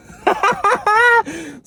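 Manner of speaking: high shrieking laugh. Real, not acted. Laughing at the idea of a manic episode, high shriek. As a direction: laugh bursts out high and squeaky. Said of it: "my laugh is so bad".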